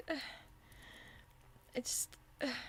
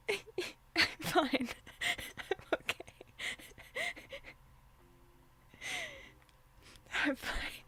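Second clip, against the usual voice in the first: mock crying; 5 semitones higher than usual. Streamer fake-crying for chat on request, laughing through sobs. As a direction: pretend sobbing apology, laughing through it. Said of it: "I'll turn my talk on".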